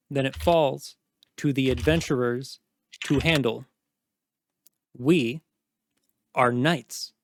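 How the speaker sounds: measured phrases with long pauses; slow, condescending. Acted. Commander explaining guild duties to a knight, slowly and condescendingly. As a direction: spell it out slowly for a subordinate. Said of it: "I'm gonna say this slowly".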